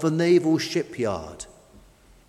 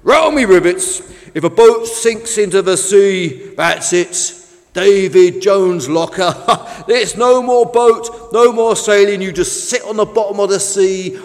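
loud, higher storytelling sea-boat voice; relishing a grim tale; 4 semitones higher, 8 dB louder than usual. A narrator's reading. Fish tells the new boat about sinking, with loud relish. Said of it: "enjoying his story".

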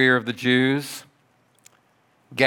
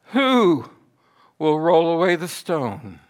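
lightly voiced women wondering, drawn-out high "who"; women's question; 5 semitones higher than usual. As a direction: raise the pitch as if asking anxiously. Voicing the women's worried question.

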